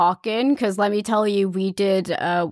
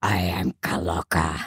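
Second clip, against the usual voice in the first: very low gravelly character voice; low gravel vigilante growl. Shown as a demonstration. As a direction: drop deep into a gravel growl. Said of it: "give us an example".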